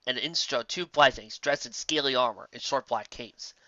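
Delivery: usual speaking voice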